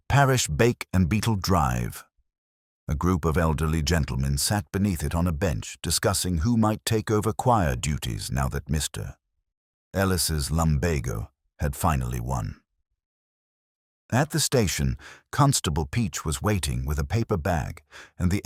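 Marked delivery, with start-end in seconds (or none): none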